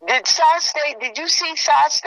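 lightly voiced gossiping friend; quoting gossipy friend. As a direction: pitch up to play the nosy friend.

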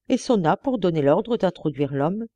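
plain reading voice

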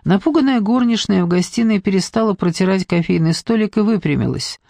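ordinary delivery

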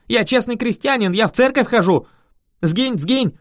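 high, loud panicked protest; hysterical. A narrator's reading. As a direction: plead and banish in the same frantic breath. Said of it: "Продолжал истерить мужчина".

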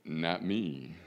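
grumbling husband. Pretend. Husband answering the shopping lover, low and dry. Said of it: "And the husband say".

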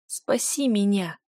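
slow, drawn-out plea; a touch tearful, stretched words; inner sobbing. A narrator's reading. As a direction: beg for rescue in understated anguish. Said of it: "рыдала мысленно".

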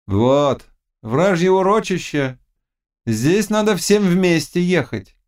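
high, slowing witch voice; warning witch. Acted. Witch warning the riders to keep together, high and slow. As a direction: announce the danger in a high pitch. Witch warns of a dangerous place.

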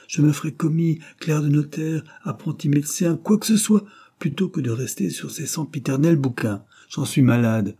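building pitch through the list, peaking on quoi que ce soit; eager pleading. A narrator's reading. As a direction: beg his mother, listing any job at all. Robert pleads, listing jobs.

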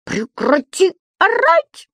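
пронзительно высоко, медленно; сорваться на визг; взвизг. A narrator's reading. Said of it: "взвизгнул господин Дауме". (Дауме визжит на Субастика, очень высоко)

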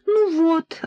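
high gasping "ну вот", a jolt of alarm; gasped. A narrator's reading. Polina hears a knock on the shutters, startled gasp. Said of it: "ахнула она".

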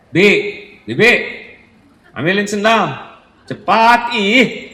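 loud, high bossy command to the maid; demeaning order. Shown as a demonstration. Mistress ordering maid for sandals, loud high demeaning command. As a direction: bark it down at her, impatient. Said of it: "itu berarti merendahkan".

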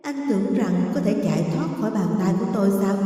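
soft, eerie ghost from hell; dark whisper behind you. A narrator's reading. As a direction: murmur like a spirit from the underworld. Ghost mocks the fleeing man.

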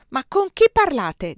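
slow, high, loud demand; shouted question. A narrator's reading. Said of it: "gridò Stefano dall'alto". (gridato dall'alto)

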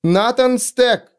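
slow, high call from far away; distant calling voice. A narrator's reading. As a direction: call the name across a great distance. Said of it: "как кто-то произнёс его имя".

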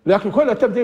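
ordinary talk

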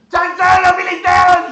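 high, full-volume warning cry; explosive shout to wake someone in danger; shouted alarm. Acted. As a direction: yell the alarm at the top of your voice. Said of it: "gritó".

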